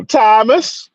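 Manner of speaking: high-pitched mock Jamaican accent; drawn-out, high Jamaican lilt; imitated Jamaican accent. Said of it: "I thought he was gonna go".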